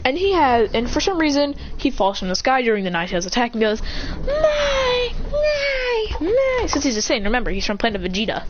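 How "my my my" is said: put-on high wailing baby cry; high-pitched wail. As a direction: let out long, high, held wails. Acts out baby Saiyan's cry.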